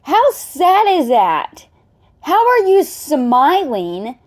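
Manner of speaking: high, slow, emphatic questioning; appalled disbelief. Real, not acted. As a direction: drag each question out, upset and incredulous. Upset at her smiling in clip.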